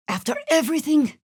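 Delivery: shocked disbelief. Acted. Companion dismayed at betrayal.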